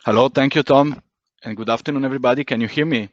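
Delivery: normal talking voice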